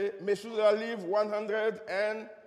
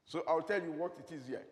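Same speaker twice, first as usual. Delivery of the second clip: ordinary delivery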